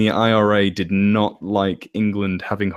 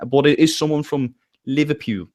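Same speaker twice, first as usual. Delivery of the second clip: Liverpool in put-on Scouse; Scouse accent; 4 semitones higher than usual. Shown as a demonstration. Naming the Scouser's home city, Scouse accent. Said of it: "it's this accent here".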